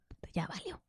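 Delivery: whispered, voiceless aside; mother whispers her dismay to herself; whispered curse. Retold or imitated. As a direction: hiss it under your breath. Whispered curse in retold story.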